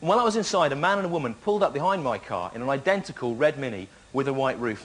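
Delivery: ordinary delivery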